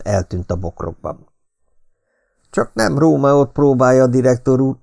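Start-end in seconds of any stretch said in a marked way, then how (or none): 2.5-4.8 laughing question. A narrator's reading. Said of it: "kérdeztem nevetve".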